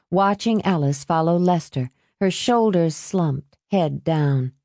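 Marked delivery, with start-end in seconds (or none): none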